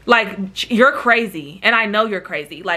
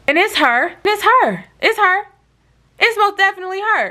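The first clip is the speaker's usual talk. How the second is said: pitched way up and louder, disbelieving; shocked recognition; 9 semitones higher, 3 dB louder than usual. Real, not acted. Teller spots the ex through the peephole, high shocked disbelief. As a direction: realize who it is and can't believe it.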